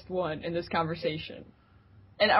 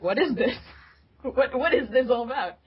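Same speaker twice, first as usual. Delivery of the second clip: puzzled surprise; 5 semitones higher than usual. Retold or imitated. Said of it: "I was like".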